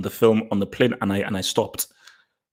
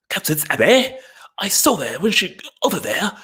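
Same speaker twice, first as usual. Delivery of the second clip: mock English accent, pitched high and swooping; hammed-up attempt at an English accent; bad English accent; 6 semitones higher than usual. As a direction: overdo a hopelessly fake English accent. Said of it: "trying to do an English accent".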